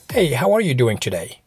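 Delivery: normal talking voice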